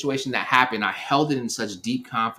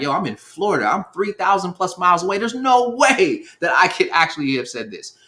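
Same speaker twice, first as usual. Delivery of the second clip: higher and louder, pleading disbelief; incredulous protest; 9 semitones higher, 6 dB louder than usual. Retold or imitated. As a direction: argue you couldn't have done it, worked up. Protesting innocence from far away.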